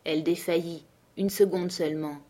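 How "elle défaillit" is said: ordinary delivery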